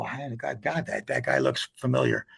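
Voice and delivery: low murmur to himself on spotting the face; a quiet, under-the-breath aside; hushed aside. Retold or imitated. Retelling the clinic visit, a hushed aside to his wife. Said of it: "and I go".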